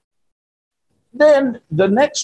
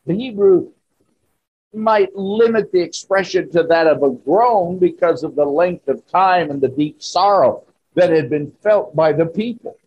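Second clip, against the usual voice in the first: ordinary talk, nothing marked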